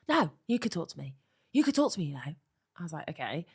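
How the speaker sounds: ordinary talk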